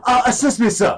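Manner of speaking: high exaggerated Japanese accent; hammy Japanese accent, pitched high. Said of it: "do your best Japanese accent".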